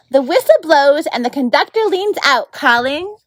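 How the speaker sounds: lively read-aloud narration; story narration. A narrator's reading. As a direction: read it like a bedtime chapter. Narrator reads chapter aloud.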